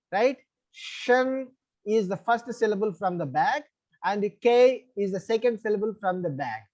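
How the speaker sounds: usual speaking voice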